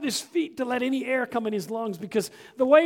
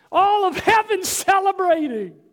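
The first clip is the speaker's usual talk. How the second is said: louder, higher, rising to a thunderous climax; preaching at full force; 6 semitones higher, 8 dB louder than usual. Acted. Pastor rousing his church, preaching at full force. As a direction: preach it out to the church, building to a roar.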